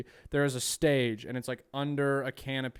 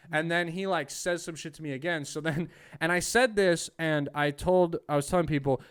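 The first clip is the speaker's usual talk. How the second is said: ordinary talk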